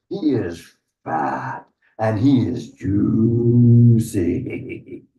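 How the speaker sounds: very slow, stretched-out relish; tempting sales pitch. Acted. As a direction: draw out each word to tempt the troll. Goat tempts troll with bigger brother.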